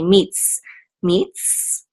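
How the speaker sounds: "meets" ending on ts, no vowel after; closed syllable. Shown as a demonstration. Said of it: "tsadi will just close out the syllable".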